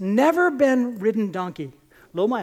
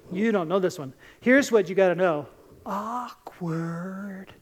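usual speaking voice

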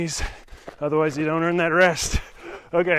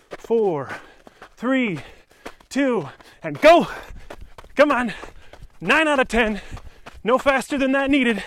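coaching shout; 7 semitones higher, 3 dB louder than usual. Real, not acted. Coach counting down interval start.

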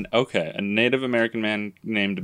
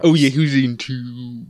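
put-on baby voice. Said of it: "this baby voice".

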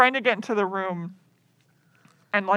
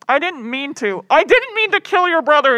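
raised, pleading protest, louder as it repeats; mock desperate excuse; 6 semitones higher, 5 dB louder than usual. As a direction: plead innocence, hammed up. Acted killer excusing himself.

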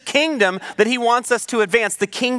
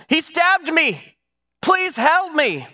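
high, drawn-out cry for help; mock yell of a stabbed victim, pitched well up; desperate yell; 9 semitones higher, slower than usual. Stabbed woman yelling to the apartments for help, acted desperate yell. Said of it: "She yelled".

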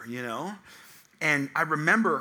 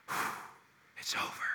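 relieved whisper; 12 dB quieter than usual. Retold or imitated. Dad at the NICU whispering it's over. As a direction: let it out barely voiced. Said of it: "and I said".

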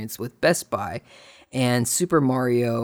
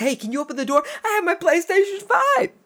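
overjoyed ask; 3 dB louder than usual. Retold or imitated. Asking stranger, overjoyed.

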